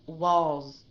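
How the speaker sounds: Walls with the z held long; long z ending. Shown as a demonstration. Teacher modeling the long z of Walls again for the student. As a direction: stretch the final z of Walls. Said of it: "listen to the durations of the Z".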